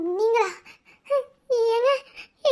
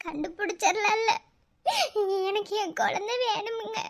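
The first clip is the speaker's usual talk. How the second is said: soft tearful plea; 4 semitones lower, 7 dB quieter than usual. Acted. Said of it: "அழாதீங்க".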